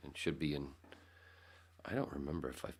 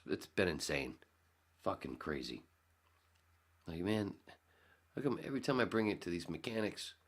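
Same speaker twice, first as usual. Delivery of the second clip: ordinary delivery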